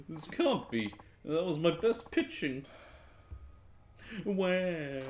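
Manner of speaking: high, hammed-up drunken wail; crying. Drinker laments losing with his best pitch, crying. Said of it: "No need to cry".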